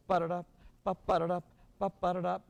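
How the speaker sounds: loud "ba-ba-da-dup" springing from the first "ba"; pickup into accent. Shown as a demonstration. Director demonstrating the rhythm, loud pickup and downbeat stress. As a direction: make the first "ba" a sneeze-like pickup into a punched second. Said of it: "treat the note at the end of the measure like a pickup note".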